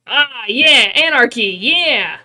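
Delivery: loud, rousing whoop; excited cheer. Real, not acted. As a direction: cheer it like a rally cry. Cheering for anarchy.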